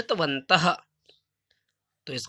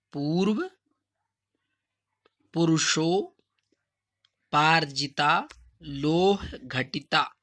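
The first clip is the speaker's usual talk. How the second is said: slow, syllable by syllable dictation; teacher dictating each word slowly; slow and clear; slower than usual. Shown as a demonstration. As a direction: spell it out slowly for students to copy. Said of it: "ये मैं धीरे, धीरे-धीरे इसलिए बोल रहा हूँ".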